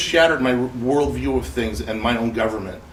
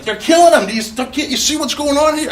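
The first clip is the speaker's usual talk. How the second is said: high, loud frantic yell; screaming; 7 dB louder than usual. Retold or imitated. Speaker yelling at expo audience, frantic scream. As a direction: scream it at the crowd, out of control. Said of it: "and I'm screaming".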